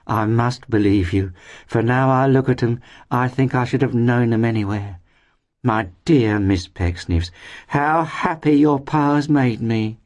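high, sentimental, gushing voice; sighing landlady. A narrator's reading. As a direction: sigh it out fondly, voice lifted high. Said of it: "sighed the good lady".

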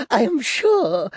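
put-on high, slow, girlish simper; giggly Flora. Flora greeting her old sweetheart Clennam, high girlish giggle. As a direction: coo it like an aging coquette. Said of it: "giggled Flora".

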